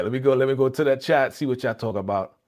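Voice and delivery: usual speaking voice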